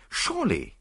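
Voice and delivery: high incredulous cry; can't believe it; a startled surely. A narrator's reading. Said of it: "he cried incredulously".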